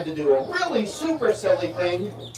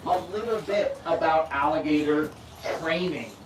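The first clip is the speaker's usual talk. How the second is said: normal talking voice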